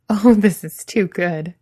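words wobbling from leftover laughter; a grin and laughter still in the voice; shaking with laughter. A narrator's reading. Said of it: "his voice still shaking".

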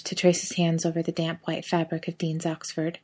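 ordinary delivery, nothing marked

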